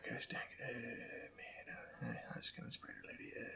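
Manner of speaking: hushed whisper under his breath. Real, not acted. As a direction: whisper it so nobody hears. Sneaking past quietly while playing.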